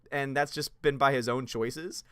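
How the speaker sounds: ordinary talk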